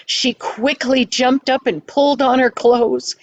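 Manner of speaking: ordinary delivery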